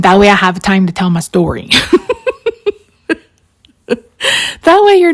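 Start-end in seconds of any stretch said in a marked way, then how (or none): 1.7-2.7 high short giggle. Real, not acted. Crafter admitting her storytelling trick, high giggle. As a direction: giggle at yourself.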